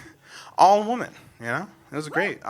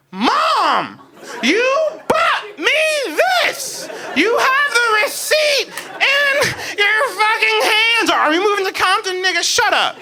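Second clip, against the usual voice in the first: high-pitched squealing outrage; indignant yell; 13 semitones higher than usual. Retold or imitated. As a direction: yell it back at the bullies, half laughing. Retold outburst at classmates, yelling.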